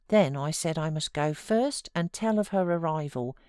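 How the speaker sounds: ordinary delivery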